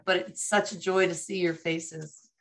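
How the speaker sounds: ordinary talk, nothing marked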